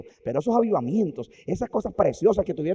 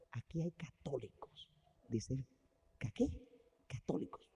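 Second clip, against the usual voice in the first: hushed whisper; 3 semitones lower, 14 dB quieter than usual. Acted. Quiet exchange in heaven.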